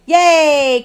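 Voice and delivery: put-on high, happy kids' yay; excited cheer. Cubs running to the dining room, cheering high. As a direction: cheer as you run for dinner. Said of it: "cried the cubs".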